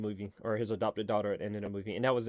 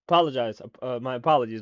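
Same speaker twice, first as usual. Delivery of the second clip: ordinary talk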